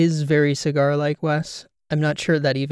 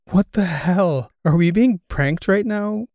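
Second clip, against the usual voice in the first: higher-pitched, startled disbelief; baffled, raised-pitch outburst; incredulous; 5 semitones higher, 4 dB louder, slower than usual. Acted. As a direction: blurt it out, pitch climbing in disbelief. Baffled by mysterious phone call.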